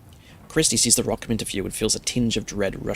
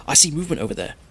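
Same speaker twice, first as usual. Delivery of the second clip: mildly excited, pitch lifted; a touch of higher excitement; 5 semitones higher, faster than usual. A narrator's reading. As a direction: point it out eagerly. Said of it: "Ronnie asks excitedly".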